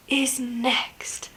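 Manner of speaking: playful secret whisper. Acted.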